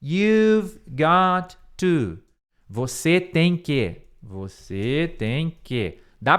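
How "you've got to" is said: "you've got to" stretched out; very slow teaching read; very slow drill. Shown as a demonstration. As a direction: spell it out slowly.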